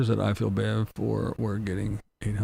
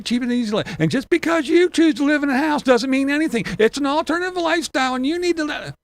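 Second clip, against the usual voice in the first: higher, louder preachy mock voice; mock preachy lecturer; 11 semitones higher, 4 dB louder than usual. Mocking van dwellers who call it a lifestyle, preachy mock voice. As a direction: lecture like a smug know-it-all. Ranting impression of hypocrites.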